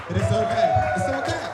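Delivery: loud, high-pitched insistence; high, loud protest played for laughs; mock defensive shout. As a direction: shout it as a comic self-justification. Comic defence of his stealing.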